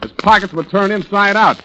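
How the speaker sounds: sharp, loud demand, pitched high; a detective losing patience with the suspect; angry interrogation. Acted. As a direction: press him hard, voice rising.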